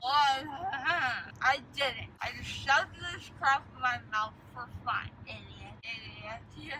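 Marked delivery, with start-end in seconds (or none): none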